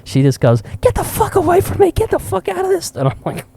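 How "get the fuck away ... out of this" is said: very high mock yell; imitated furious mom. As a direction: drive him off like a frantic Karen. Retold mom yelling at old man.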